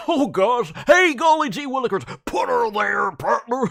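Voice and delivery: hyper bouncy golly-gee neighbor; really into it. Shown as a demonstration. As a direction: throw your whole body into it. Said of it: "You can get really, really into it".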